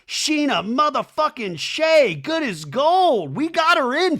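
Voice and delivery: high, drawn-out triumphant call; gleeful, stretched-out proclamation; mock hype announcer. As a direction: announce her like a star entering the arena. Mock hype announcement of Sheena's arrival.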